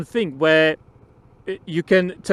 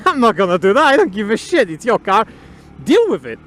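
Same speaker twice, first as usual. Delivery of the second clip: louder, mock-indignant rebuff; defiant refusal; 2 semitones higher, 4 dB louder than usual. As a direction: refuse the pushy guy forcefully. Acted refusal to rude stranger.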